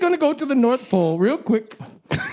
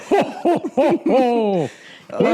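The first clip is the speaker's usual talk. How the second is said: Santa laugh; much slower than usual. Acted.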